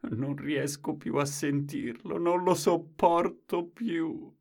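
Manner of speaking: put-on slow, strained old woman pleading; exasperated grandmother. A narrator's reading. Grandmother fed up with grandfather, slow complaint. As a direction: drag it out, fingers in the ears.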